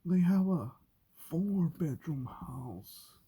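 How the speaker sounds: high, loud gushing awe; hammed-up envious wonder, drawn out at the end; mock gushing. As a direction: gush like someone dazzled by a big house. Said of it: "I'm like".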